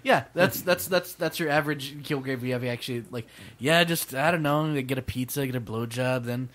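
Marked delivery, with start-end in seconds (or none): none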